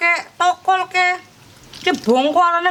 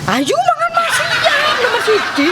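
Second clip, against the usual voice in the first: laughing; 11 semitones higher than usual. Real, not acted. Grandma laughing invites to eat.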